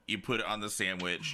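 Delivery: usual speaking voice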